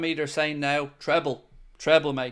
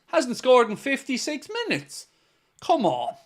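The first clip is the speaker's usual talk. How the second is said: higher, put-on American owner voice; hammy impression of the club owner; John W. Henry impression; 9 semitones higher, 3 dB louder than usual. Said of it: "That's my best John W. Henry".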